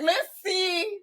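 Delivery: high, teasing Let's see; playful challenge. Retold or imitated. Scorpio testing a lover, high teasing dare. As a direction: dare her sweetly and high. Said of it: "we gonna be like".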